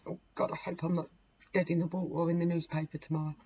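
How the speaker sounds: usual speaking voice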